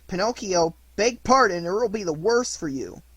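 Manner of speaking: loud, high warning; threatening. A narrator's reading. Boy threatening Pinocchio to beg pardon, loud and high. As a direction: demand an apology, loud.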